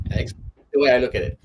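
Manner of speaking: usual speaking voice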